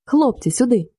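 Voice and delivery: mildly excited summons; a touch of joyful discovery; joyful call. A narrator's reading. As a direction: call friends over with gladness. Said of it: "і радісно скрикнув".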